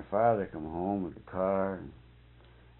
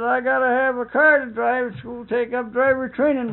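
high, louder wheedling call; pleading daughter; 12 semitones higher, 5 dB louder than usual. Retold or imitated. Daughter begging her father for a car. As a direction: coax daddy like a spoiled girl.

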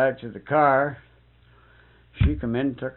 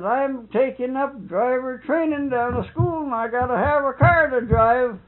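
high, louder wheedling call; pleading daughter; 12 semitones higher, 5 dB louder than usual. Retold or imitated. Daughter begging her father for a car. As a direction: coax daddy like a spoiled girl.

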